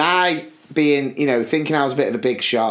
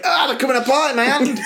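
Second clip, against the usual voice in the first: mock panic; 11 semitones higher, faster than usual. Retells panic peeling sausages.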